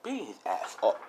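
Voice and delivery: plain reading voice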